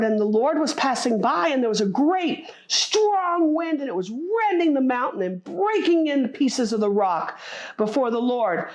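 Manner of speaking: loud, driving sermon delivery; full-force preaching. Real, not acted. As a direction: preach it out to the congregation.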